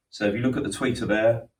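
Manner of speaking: usual speaking voice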